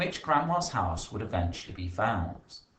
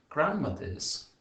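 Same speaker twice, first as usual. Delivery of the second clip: slow thinking aloud; 7 semitones higher, slower than usual. Shown as a demonstration. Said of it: "I'm gonna think".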